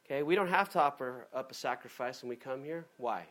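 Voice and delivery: ordinary talk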